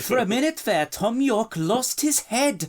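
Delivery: high, hammed-up Australian accent drifting Welsh; Australian accent slipping toward Welsh; bad Australian accent. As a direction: read the post in a failing Australian accent. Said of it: "Your Welsh accent is actually getting worse".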